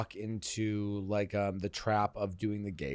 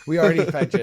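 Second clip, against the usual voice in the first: mock high quote; 6 semitones higher, 12 dB louder, much faster than usual. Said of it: "is crazy".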